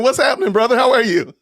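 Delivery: bright, high welcome to a friend; excited greeting. Real, not acted. Host welcoming the guest from Australia, excited and high.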